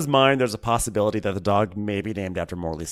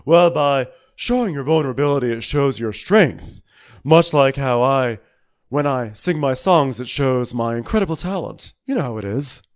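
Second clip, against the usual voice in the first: Luann impression; 4 dB louder than usual. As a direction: declare it grandly, drawing the words out. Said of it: "and Luann goes".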